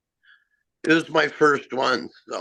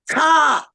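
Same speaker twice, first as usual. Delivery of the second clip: high, loud crow caws; three sharp cawing calls; imitated crow caw; 10 semitones higher, 5 dB louder than usual.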